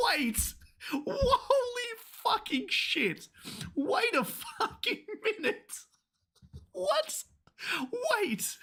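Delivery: shrieking high with laughter and disbelief; ecstatic screaming. Real, not acted. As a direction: scream it out in pure hype, voice cracking. Shocked screaming at manga panel.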